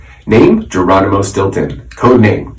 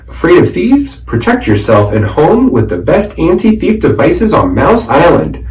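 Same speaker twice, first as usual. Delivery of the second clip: higher, punchy billboard slogan read; 7 semitones higher than usual. Acted. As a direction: sell it like a commercial.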